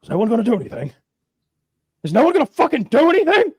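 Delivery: rising to a high, loud, fast frustrated yell; the outsider losing it at everyone; exasperated outburst. Retold or imitated. Englishman yelling at the frozen samurai, exasperated.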